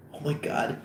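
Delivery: scared, shaky half-whisper; frightened. Acted. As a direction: gasp it softly, afraid to ask. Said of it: "Fogel, frightened".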